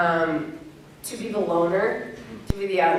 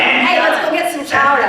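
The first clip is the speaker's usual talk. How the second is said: loud hammed-up Boston; Boston accent; 5 semitones higher, 5 dB louder than usual. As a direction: shout it like a Bostonian. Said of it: "Two more".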